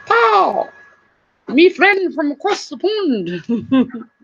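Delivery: slow, playful imitation of a British accent; a friendly British accent, drawn out; mock British accent. Greeting her friend from across the pond in a mock British accent. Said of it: "He's got such a cool accent".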